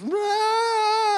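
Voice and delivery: put-on sudden high loud wail like a bawling kid; child's wail. Child crying after toy taken.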